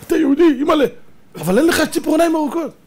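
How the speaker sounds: put-on high, surprised child's voice; a wide-eyed kid amazed; mock child surprise.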